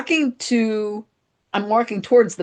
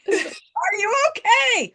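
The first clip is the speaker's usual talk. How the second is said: very high, drawn-out shout; mock yell; 13 semitones higher, slower than usual. Retelling husband calling to stuck wife, mock yell. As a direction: call it out slowly, imitating a worried onlooker. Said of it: "He's yelling".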